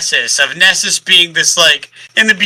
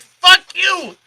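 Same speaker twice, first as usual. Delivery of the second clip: exasperated; 13 semitones higher than usual. Retold or imitated. Reader's retort to gloating alien.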